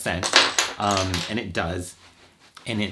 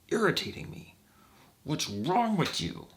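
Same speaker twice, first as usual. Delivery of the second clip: mock annoyance; 8 semitones higher than usual. Mocking an annoyed person.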